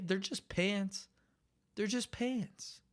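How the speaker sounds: high, slow exasperated plea. Acted. Exasperated at a listener who strips off pants at home.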